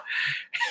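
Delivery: short unvoiced high laugh; a quick airy chuckle; breathy laugh. Real, not acted. Laughs after choking quote.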